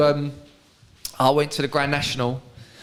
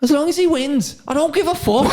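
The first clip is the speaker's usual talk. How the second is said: high-pitched, badly imitated Scouse punchline; mock Scouse accent; 11 semitones higher, 3 dB louder than usual. Scouse lad burying the protester, in high mock Scouse accent. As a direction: deliver the punchline in hammed-up Scouse. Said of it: "finish it with the accent now".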